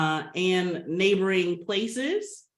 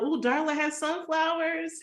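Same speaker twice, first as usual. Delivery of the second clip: delighted greeting; 9 semitones higher than usual. Real, not acted. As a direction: voice jumps up high with delight. Greeting attendee's sunflower background.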